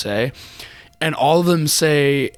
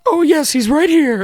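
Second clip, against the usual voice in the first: much higher, louder put-on voice; mock receptionist; 6 dB louder than usual. Suggesting how to answer the bank in a fake voice.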